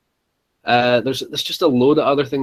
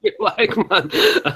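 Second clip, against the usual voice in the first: laughing; 9 semitones higher, much slower than usual. Real, not acted. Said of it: "laughing at disbelief".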